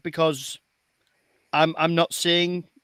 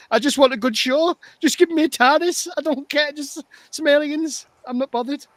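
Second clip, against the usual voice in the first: raised high, pleading, a little louder; exasperated fan begging for a simple show, pitched up high; exasperated plea; 8 semitones higher, 3 dB louder than usual. Real, not acted. Fan sums up what he wants from the show, high exasperated plea.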